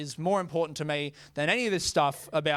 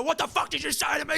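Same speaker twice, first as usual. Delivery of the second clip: put-on much higher, aggressive snarl of a drunk woman; furious confrontation; 10 semitones higher than usual. Drunk woman grabbing the older lady, much higher furious snarl. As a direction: go at her like you want a fight. Lady grabs and shakes passenger.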